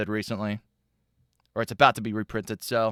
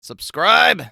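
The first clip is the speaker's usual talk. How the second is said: loud call; 5 semitones higher, 11 dB louder than usual. Real, not acted. Urging viewers to subscribe.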